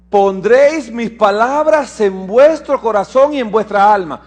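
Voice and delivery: loud, high scripture declamation; lectura de las Escrituras. Acted. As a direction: proclaim the verse to the congregation. (lectura bíblica proclamada con fuerza)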